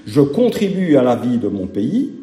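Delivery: ordinary delivery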